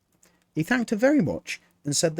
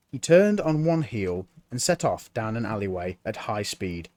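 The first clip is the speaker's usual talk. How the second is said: usual speaking voice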